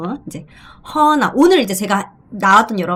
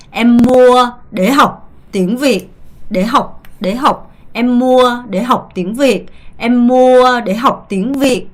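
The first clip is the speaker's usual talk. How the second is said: "Em mua để học tiếng Việt" said slowly, with "mua" held long and falling; slow model sentence; 2 semitones higher, 4 dB louder, much slower than usual. Shown as a demonstration. Teacher models the sentence slowly for students to repeat. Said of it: "이렇게 발음하시면 되고요".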